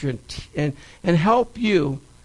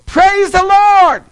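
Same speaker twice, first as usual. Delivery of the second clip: high, loud drawn-out shout of praise; joyful shout; 13 semitones higher, 9 dB louder, slower than usual. Retold or imitated. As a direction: shout it out from the porch, stretching the words. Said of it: "she would shout out".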